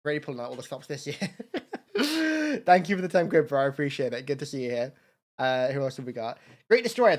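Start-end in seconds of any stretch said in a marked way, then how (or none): 1.2-2.6 high, squealing burst of laughter. Real, not acted. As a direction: let a giddy laugh break out. Big laugh at own joke.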